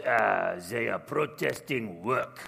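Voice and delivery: low, slow imitation French accent. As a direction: mimic a Frenchman's drawn-out accented English. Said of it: "kind of French accent".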